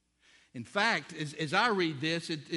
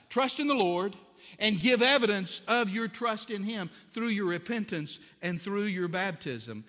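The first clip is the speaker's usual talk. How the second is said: louder, higher exhortation; preaching at full force; 6 semitones higher, 8 dB louder than usual. Acted. Pastor urging his congregation to trust, loud and fervent. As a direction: urge them to trust.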